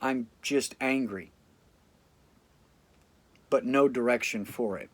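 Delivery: ordinary delivery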